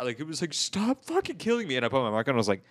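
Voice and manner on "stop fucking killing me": high, slow mock whine; crying kid. Player imitates the kid begging him to stop, mock crying. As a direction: imitate a tearful kid pleading. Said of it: "the kid was like, cry".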